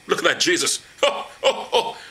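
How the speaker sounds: jeering, cackling taunt; mocking laughter. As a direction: laugh down at him while taunting. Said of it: "they shall mock him".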